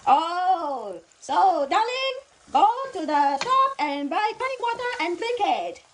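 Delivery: put-on high, loud Chinese-accented doctor; high-pitched mock Chinese accent; imitated Chinese accent. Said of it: "making her accent".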